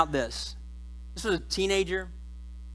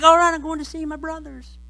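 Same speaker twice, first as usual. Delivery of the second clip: cheerful Joseph; 8 semitones higher than usual. Acted. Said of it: "But he's just like".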